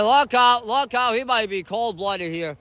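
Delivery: ordinary delivery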